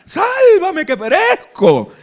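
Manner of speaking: high, loud, slow hammed-up plea through a laugh; mock desperate cry. Peter sinking in the sea, hammed-up desperate cry for help. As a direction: wail it like a sinking man. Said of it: "ahí es donde grita".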